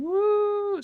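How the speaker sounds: a high, loud whoop of joy; sudden delighted woo; excited whoop. Real, not acted. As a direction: cheer for your favourite game. Cheering for favourite game.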